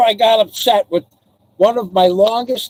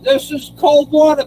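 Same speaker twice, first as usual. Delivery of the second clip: usual speaking voice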